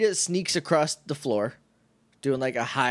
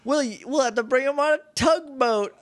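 mock Rocky; 9 semitones higher than usual. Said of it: "Rocky's like".

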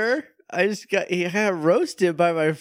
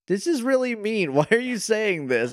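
high, hurt protest; upset Buffy; 8 semitones higher than usual. Retold or imitated. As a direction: pitch up as a wounded daughter, with a laugh. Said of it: "And she's like".

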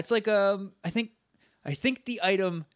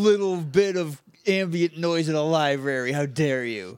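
annoyed nerd voice; 3 semitones higher, 3 dB louder than usual. Retold or imitated. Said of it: "this friggin' nerd behind him is like".

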